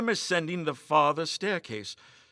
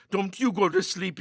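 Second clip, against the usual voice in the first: anxious; 5 semitones higher, 5 dB louder than usual. A narrator's reading. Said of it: "he said anxiously".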